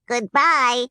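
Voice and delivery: Munchkins' goodbye. Acted. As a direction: wave off cheerfully as a group. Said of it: "said the three little Munchkins".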